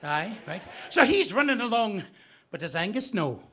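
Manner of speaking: normal talking voice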